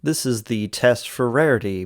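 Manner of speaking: normal talking voice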